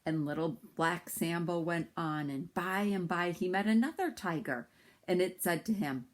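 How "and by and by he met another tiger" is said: ordinary delivery, nothing marked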